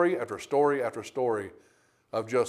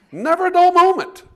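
"Never a dull moment" shouted much higher and louder; shouted refrain; 12 dB louder than usual. Shown as a demonstration. Preacher repeating his punchline to the congregation, shouting. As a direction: belt out "Never a dull moment" like a rallying cry.

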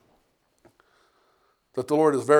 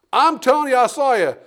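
much higher and louder, sure of it; insistent accuser; 9 semitones higher, 6 dB louder than usual. Acted. Bystander insisting to Peter that he saw him, much higher and louder. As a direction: press him, certain you saw him.

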